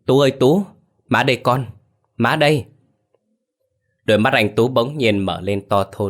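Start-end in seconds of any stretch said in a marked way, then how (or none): 0.1-2.6 calling her son's name higher and louder, urgently; hurried calling out. A narrator's reading. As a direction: call out to the waking son, pitch raised. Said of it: "Bà gọi vội". (gọi vội, cao và to)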